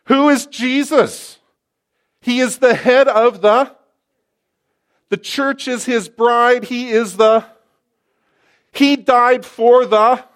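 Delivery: preacher in full flight; full-force preaching. Acted. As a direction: thunder the truth at them.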